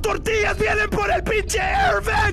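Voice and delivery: panicked loud yell; screaming. Real, not acted. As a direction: scream in terror at the vent. Screaming as monster appears.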